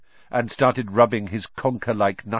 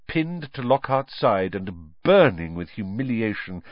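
ordinary delivery

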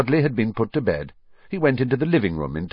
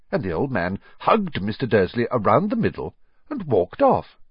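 usual speaking voice

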